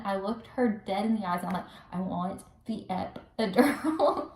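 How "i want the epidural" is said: slow, spaced-out demand; mock-intense insistence; retold firm demand. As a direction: spell it out word by word. Looks her dead in eyes.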